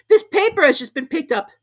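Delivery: high, loud hurried rabbit. A narrator's reading. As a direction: rush it as the rabbit.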